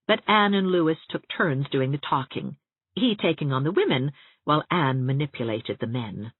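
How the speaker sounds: plain reading voice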